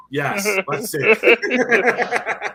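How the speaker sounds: hyped. Pretend. Mock eager hype, slow.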